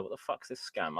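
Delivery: ordinary delivery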